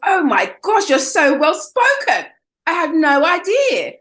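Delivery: high, slow mock astonishment; mock amazement. Caller amazed she speaks well, high slow mockery. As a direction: drag out the fake surprise.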